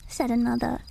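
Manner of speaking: ordinary delivery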